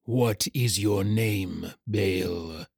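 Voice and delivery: slow, looming creature voice; ominous shadow rider. Acted. Dark rider demands the helpless hatchling's name, ominous and slow. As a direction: draw it out, sinking low and soft at the end.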